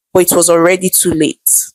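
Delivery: usual speaking voice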